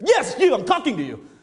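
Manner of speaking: loud, high, impatient shout; yelling. Retold or imitated. Speaker confirming to a stunned attendee whom he means, yelling. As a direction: yell it straight at the one person you mean. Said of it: "He was yelling at me".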